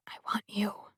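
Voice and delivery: breathy unvoiced whisper against his lips. A narrator's reading. Narrator whispering her want to Sebastian. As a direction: hush it, barely voiced, close and intimate. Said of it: "I whisper against his mouth".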